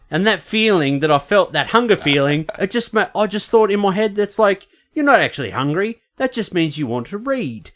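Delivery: high-pitched, put-on interview voice. Actor explains to interviewers how he lost weight, high imitation.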